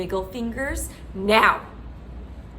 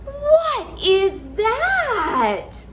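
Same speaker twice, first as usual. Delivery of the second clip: surprised; 10 semitones higher, 3 dB louder, much slower than usual. Shown as a demonstration. Surprised reveal.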